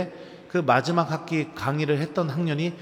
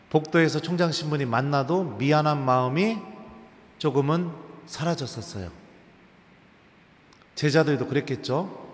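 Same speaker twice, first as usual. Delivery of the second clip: ordinary delivery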